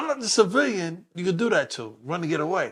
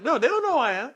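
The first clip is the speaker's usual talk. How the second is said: heated retort; 11 semitones higher, 3 dB louder than usual. Retold or imitated. Arguing with the officer.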